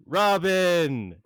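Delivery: high, loud, drawn-out name; joyful Robin call. Acted. Wally hugging Robin, high joyful call. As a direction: call his name with delight. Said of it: "he goes".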